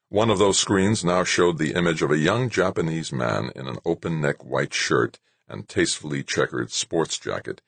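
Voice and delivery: ordinary talk, nothing marked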